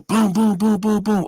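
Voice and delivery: loud, high booms; door banging. Retold or imitated. Guards hitting the cell door, loud banging noises. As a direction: pound out the knocks.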